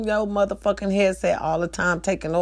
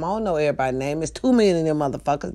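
ordinary talk, nothing marked

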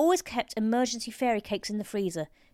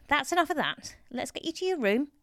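higher, coaxing nurse voice; ingratiating; 6 semitones higher than usual. A narrator's reading. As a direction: coax an old patient along. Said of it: "She smiled ingratiatingly at him".

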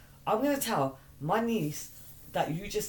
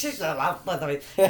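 ordinary talk, nothing marked

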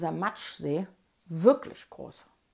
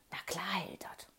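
hushed, unvoiced whisper; whispered answer; 3 semitones lower, 13 dB quieter than usual. Bettina whispers back to friend from hiding. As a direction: breathe the reply softly. Hidden children answer quietly.

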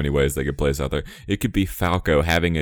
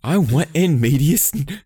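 mock whine; 11 semitones higher, 3 dB louder, slower than usual.

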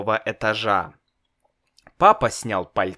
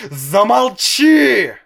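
slow, much louder and higher furious shout; 12 semitones higher, 9 dB louder, slower than usual. A narrator's reading. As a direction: bellow at the boy to shut up. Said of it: "закричал папа".

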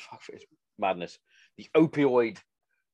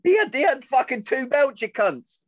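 indignant outburst; 5 dB louder than usual. Real, not acted. Outraged for the disrespected fighter.

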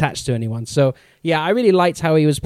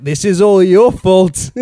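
slow, louder mock accusation; mock accusing; 3 semitones higher, 6 dB louder, slower than usual. Flash blaming the others after tackling Superman, louder. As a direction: point the finger and pin the blame. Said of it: "He's like".

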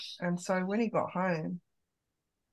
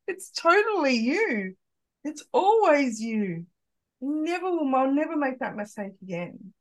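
earnest reassurance; 6 semitones higher, 6 dB louder than usual. Retold or imitated. Mum reassuring her son.

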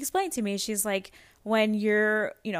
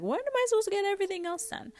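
high, fast exasperated parent; mock protest; 14 semitones higher, much faster than usual. Parent answering sleep-when-baby-sleeps advice, mock protest. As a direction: fire it back in a much higher voice.

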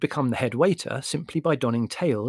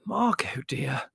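horrified; 2 semitones higher, slower than usual. A narrator's reading. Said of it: "exclaimed Mother, horrified".

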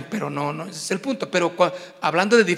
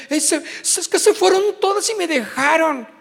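whiny complaint; 7 semitones higher than usual. Retold or imitated. Voicing a complaining member.

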